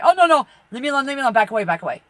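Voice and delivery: loud, fast panicked pleading; frantic leave me alone. Retold or imitated. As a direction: shoo them off in a panic. Pushing spirits away.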